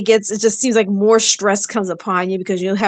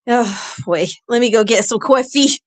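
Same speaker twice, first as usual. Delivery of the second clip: ordinary talk, nothing marked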